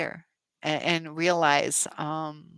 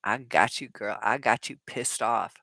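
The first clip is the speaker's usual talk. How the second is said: 1 playful taunt; 6 semitones lower than usual. Retold or imitated. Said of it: "it's kinda playful to them sometimes too".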